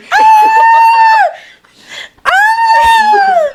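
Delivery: very high loud shriek; old lady scream. Retold or imitated. Auntie drenched by the hose, loud high shriek. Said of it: "Like that".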